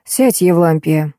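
ordinary delivery

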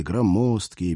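plain reading voice

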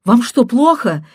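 loud, high, urgent shouting; shouting caller. Acted. Caller shouts at the husband to stop the affair. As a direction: shout and beg him to act. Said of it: "кричала она".

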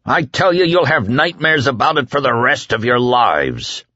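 frantic appeal. A narrator's reading. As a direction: hammer the crowd with questions. Whitney haranguing crowd.

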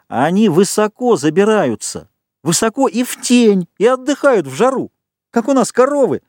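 high, eager explaining; keyed-up, exultant high voice; jubilant excitement. A narrator's reading. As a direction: pour it out excitedly, pitch way up. Jubilant Jack describes pigs' hideout.

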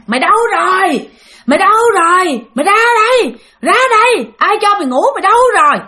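put-on high, loud drunk shouting; drunken yelling. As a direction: yell for the wife, loud and high. Ba say về la gọi mẹ. (giọng say xỉn la hét, to và cao)

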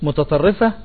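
ordinary talk, nothing marked

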